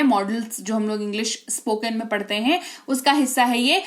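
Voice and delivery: ordinary talk, nothing marked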